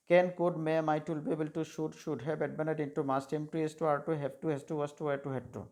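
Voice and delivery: rapid-fire recitation. Shown as a demonstration. Teacher repeating the drill for students to copy. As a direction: reel off the modal list quickly in one breath. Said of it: "এরকম করে তোমরা শিখবে".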